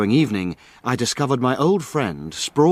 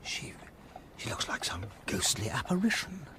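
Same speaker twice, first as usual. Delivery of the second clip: much softer, a near-breathless hush; hushed awe; 14 dB quieter than usual. Acted. Awed friend hushed at the figure under the lamppost.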